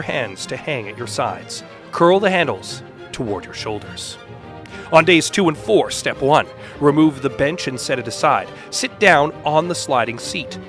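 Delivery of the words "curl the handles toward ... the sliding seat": ordinary talk, nothing marked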